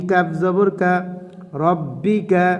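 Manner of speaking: ordinary delivery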